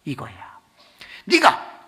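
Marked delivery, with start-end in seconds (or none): none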